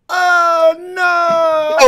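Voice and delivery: loud high hype yell; red rat shout. Retold or imitated. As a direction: bellow it like a dancehall hype call. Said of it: "I have to go".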